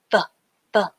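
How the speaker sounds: soft, clipped "the". Shown as a demonstration. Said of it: "you say it a little bit softer".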